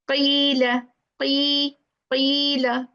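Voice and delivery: "Qila" with a long, held ee; extended ya. Shown as a demonstration. Teacher shows ya madhiya after kasra, extended long i. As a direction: stretch the "qi" in "qila". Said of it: "have to extend that letter".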